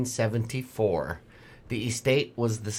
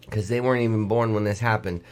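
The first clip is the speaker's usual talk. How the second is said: ordinary delivery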